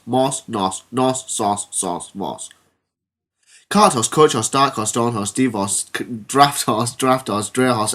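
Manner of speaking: normal talking voice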